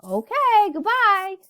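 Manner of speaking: high, loud cut-off goodbye; high hasty goodbye. Retold or imitated. Hanging up on chatty Yancey, high hasty goodbye. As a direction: hurry off the phone brightly.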